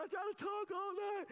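high-pitched strained club holler; shouting over music. Acted. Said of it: "try to talk over all of that".

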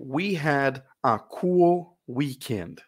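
"We had a cool weekend" drawn out word by word; very slow model reading. Shown as a demonstration. Teacher modeling the sentence very slowly for students to repeat. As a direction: spell each word out slowly for the class to repeat. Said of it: "Leiam comigo".

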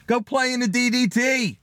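high, hammed-up parental urging; mock parent. Parents sending kids into bug spray, high mocking imitation.

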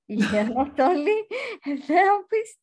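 laughing and high-pitched. Real, not acted. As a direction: laugh through the words. Teasing the guest, laughing.

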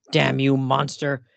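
angry accusation. Retold or imitated. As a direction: spit it out slowly like a wronged hero. Retold vampire's angry accusation.

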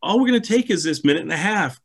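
ordinary talk, nothing marked

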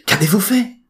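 high, loud character voice; high-pitched anxious question; alarmed questioner. A narrator's reading. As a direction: demand an explanation, high and alarmed. Worried woman questions professor.

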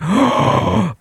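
sharp, high intake of breath; big gasp at something adorable; dramatic reenacted gasp; retold gasp. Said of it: "I was like".

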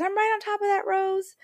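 movie quote voice. Retold or imitated. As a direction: toss it off like a chipper movie heroine. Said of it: "when she says".

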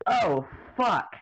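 a hint of a snowman character flubbing a line; Olaf voice. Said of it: "and then in the Olaf voice".

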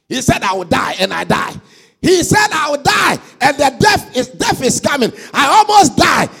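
loud, high, shouted preaching; full-force preaching. Acted. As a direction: hammer the story home loudly.

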